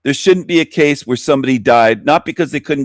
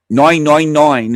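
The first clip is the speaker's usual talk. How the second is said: louder, put-on British accent; mock British accent; 3 dB louder, slower than usual. Shown as a demonstration. Host mimicking Great Britain's emergency number, mock British accent.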